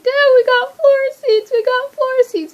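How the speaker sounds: loud, high hysterical squeal; tearful-sounding, overjoyed shriek; excited screaming. Retold or imitated. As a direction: scream the big news down the phone to dad. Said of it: "like, screaming and so excited".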